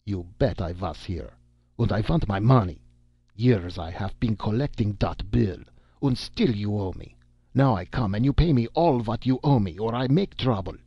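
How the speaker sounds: put-on insistent German-accented demand; German accent, building to a threat; irate German American. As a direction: play a creditor with a thick German accent. Story's German American demands his money.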